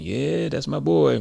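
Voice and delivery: high, loud and drawn-out, acted; proud boast. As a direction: stretch it out like a crowing dad.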